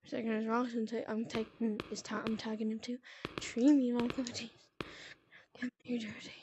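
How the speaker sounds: hushed, breathy whisper. Real, not acted. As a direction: whisper so nobody hears. Hiding, talking under breath.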